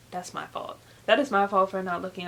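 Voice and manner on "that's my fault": whispered aside. Real, not acted. Muttered aside after forgetting name.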